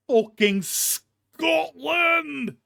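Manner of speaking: drawn-out, high, loud disbelieving shout; half-laughing outraged yell at the casting; exasperated shout. Real, not acted. Exasperated rant about Garp's accent.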